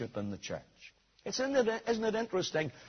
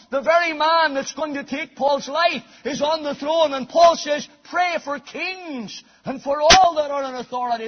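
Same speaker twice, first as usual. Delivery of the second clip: higher, louder pulpit build; fervent preaching; 8 semitones higher, 7 dB louder than usual. Acted. Preacher urging prayer for rulers, high and loud. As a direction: rise into a passionate appeal.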